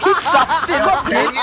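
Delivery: laughing. Real, not acted. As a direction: crack up while saying it. Laughing at a friend's play.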